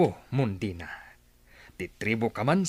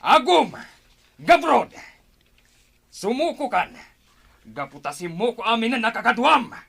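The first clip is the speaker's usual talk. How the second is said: loud, higher angry shouting; furious chief demanding surrender; angry retort; 9 semitones higher, 5 dB louder than usual. Acted. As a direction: throw the insult back hard. Chief confronts captive.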